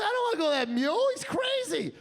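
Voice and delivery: fast, rising panicked plea; pleading not to ride the crazy mule; scared protest. Retold or imitated. Comic refusing the mule, in a fast panicked plea. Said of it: "I said".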